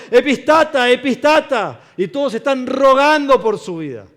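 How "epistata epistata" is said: high, loud pleading cry; desperate shout. Acted. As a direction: beg for your life. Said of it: "gritando en desesperación". (grito desesperado)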